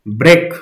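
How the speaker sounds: Break said alone with firm pressure; stressed demonstration word. Shown as a demonstration. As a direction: demonstrate where the weight falls in break. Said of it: "वो pressure कहाँ बन रहा है".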